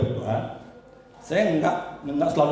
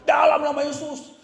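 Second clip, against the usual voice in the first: high, slow exorcism command; battling command; 11 semitones higher than usual. Retold or imitated. Preacher reenacting warfare against the demon, battling command. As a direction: stretch it out high, commanding the spirit. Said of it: "saya perangi terus".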